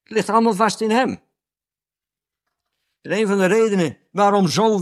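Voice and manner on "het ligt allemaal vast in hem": ordinary talk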